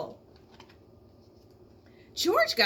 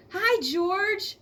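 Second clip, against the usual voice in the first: put-on high, louder girl calling a greeting; 9 semitones higher, 4 dB louder than usual. A narrator's reading. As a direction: call out brightly across the room. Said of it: "called out the little girl".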